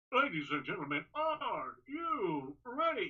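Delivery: calm, drawn-out famous ring call, rising on ready; Michael Buffer. Retold or imitated. Lightly voiced famous ring announcer, calm and drawn out. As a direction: stretch the call out slowly like the legend. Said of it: "He's talking very calm".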